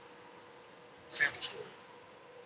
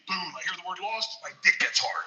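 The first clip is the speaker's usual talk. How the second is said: ordinary talk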